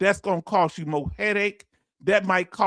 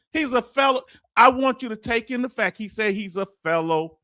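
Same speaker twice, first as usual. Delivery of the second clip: usual speaking voice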